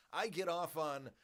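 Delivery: ordinary delivery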